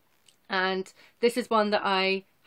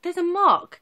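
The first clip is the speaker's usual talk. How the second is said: dismayed; 10 semitones higher, 7 dB louder than usual. Retold or imitated. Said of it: "I was like".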